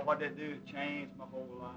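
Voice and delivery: normal talking voice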